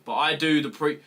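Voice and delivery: ordinary talk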